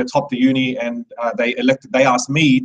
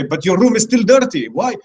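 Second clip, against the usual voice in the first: raised, higher scolding dad; mock dad scolding; 9 semitones higher, 4 dB louder than usual. Son retelling how his dad scolded him, higher and louder. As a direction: voice dad's complaint, pitched up. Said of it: "my dad would say".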